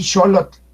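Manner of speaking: xolot with two ordinary short Os; both Os short. Shown as a demonstration. Teacher giving the word for wrinkled, both Os short. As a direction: say xolot briskly with neither vowel held. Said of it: "con las dos O normal".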